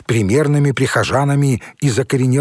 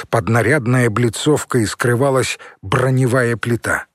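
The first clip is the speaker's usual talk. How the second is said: usual speaking voice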